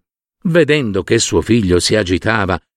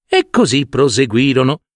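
plain reading voice

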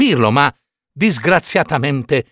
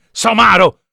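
louder, higher call of Somaro; scolding shout; 7 semitones higher, 5 dB louder than usual. Acted. The cricket shouts Somaro at Pinocchio. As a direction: scold him sharply.

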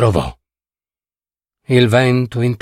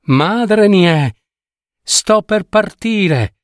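calling from the river; 6 semitones higher, slower than usual. Acted. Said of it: "chiamò dal fiume".

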